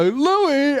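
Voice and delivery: high-pitched mock woman's call; high sing-out of a name; imitated woman calling. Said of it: "this woman go".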